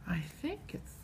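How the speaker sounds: near-whispered, tentative thinking aloud; hushed guessing. Pretend. Teacher feeling a hidden letter in a bag, whispered guess. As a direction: whisper it as you puzzle it out.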